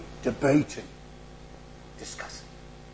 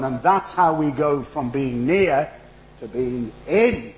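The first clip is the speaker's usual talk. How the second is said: louder, rising preacher's voice; preaching at full force; 4 semitones higher, 5 dB louder than usual. Acted. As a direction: drive the message home to the congregation. Preaching the sermon's climax.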